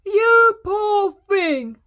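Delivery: high, loud, slow sympathy; booming giantess. A narrator's reading. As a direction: boom it out pityingly, drawn out. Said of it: "boomed the giantess".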